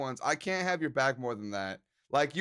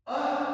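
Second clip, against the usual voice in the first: high awed uh; awed gasp; 11 semitones higher than usual. Retold or imitated. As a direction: gasp at the art. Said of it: "when I saw it, I was like".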